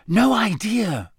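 ordinary delivery, nothing marked

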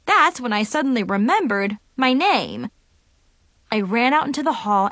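ordinary delivery

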